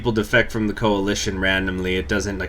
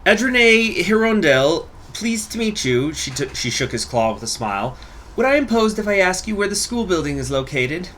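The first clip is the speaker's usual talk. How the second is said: put-on polite young teacher; 9 semitones higher than usual. Teacher introduces herself to the farmer, politely. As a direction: speak up sweetly and properly.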